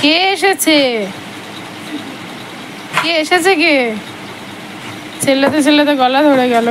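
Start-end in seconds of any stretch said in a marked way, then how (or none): 0.0-3.9 pet talk. Real, not acted. Asking dog who has come.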